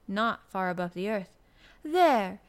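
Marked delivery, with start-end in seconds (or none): 1.8-2.4 loud, high cry of triumph; punchy exultant exclamation. A narrator's reading. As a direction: burst out with it triumphantly. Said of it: "he said exultantly".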